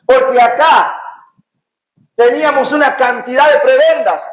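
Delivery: high, impassioned pulpit delivery; fervent preaching. Acted. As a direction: proclaim it fervently to the congregation. Preaches at full force.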